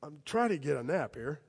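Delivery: drowsy, annoyed mumble; just woken and grumpy; mildly put-on sleepy grumble; groggy Jesus. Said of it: "And he says".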